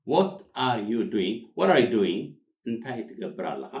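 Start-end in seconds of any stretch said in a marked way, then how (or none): 0.1-1.3 slow, each word set apart; slow word-by-word model. Shown as a demonstration. Teacher says it word by word. 1.6-2.2 louder, words run together; natural linked delivery. Shown as a demonstration. Teacher says it linked.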